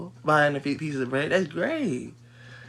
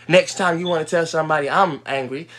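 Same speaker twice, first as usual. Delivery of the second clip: ordinary talk, nothing marked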